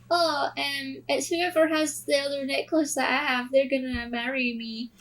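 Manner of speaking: normal talking voice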